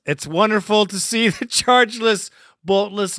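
ordinary delivery

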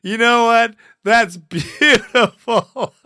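laughing praise; delighted. Real, not acted. Host loving the rant.

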